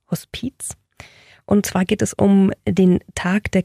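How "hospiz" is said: breathy hush on the word; whispered. Acted. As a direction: whisper it like a secret. Host evokes goosebumps at word.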